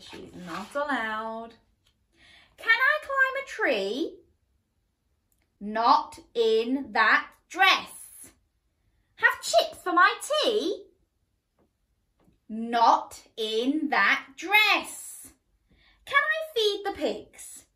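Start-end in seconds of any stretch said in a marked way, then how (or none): 2.6-4.2 put-on much higher, eager little girl's ask; high hopeful princess voice; pleading girl. Princess begging the Queen. 5.6-8.3 loud, very slow flat refusal; stern queen. Acted. Queen refuses each request. 9.2-10.9 put-on much higher, eager little girl's ask; high hopeful princess voice; pleading girl. Princess begging the Queen. 12.5-15.3 loud, very slow flat refusal; stern queen. Acted. Queen refuses each request.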